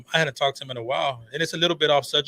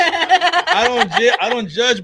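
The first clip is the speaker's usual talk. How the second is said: laughing denial; 8 semitones higher, 8 dB louder, much slower than usual. Real, not acted. Denies judging, laughing.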